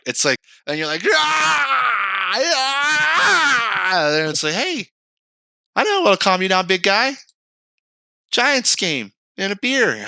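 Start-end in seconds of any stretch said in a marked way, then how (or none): 1.1-4.2 high, drawn-out hammed-up cry of frustration; mock anguished yell. Guy reading a petition email, yelling in mock rage. 4.5-10.0 higher, coaxing voice for a big guy; mock coaxing. Friend calming the raging guy, mock coaxing. Someone soothing the angry guy.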